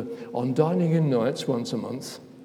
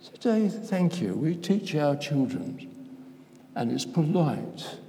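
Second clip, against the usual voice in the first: ordinary talk, nothing marked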